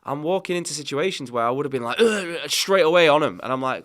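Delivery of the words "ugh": exasperated ugh. Retold or imitated. Retells snapping at stepson.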